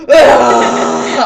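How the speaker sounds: exaggerated burst into tears; mock bawling. Holding back tears until someone touches you, mock bawling. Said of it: "you just start bawling".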